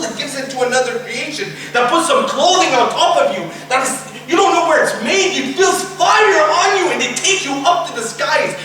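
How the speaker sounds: high, forceful sermon delivery; impassioned preaching. Acted. Preacher describing a sinful soul's torment, impassioned preaching. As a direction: drive the warning home at full force.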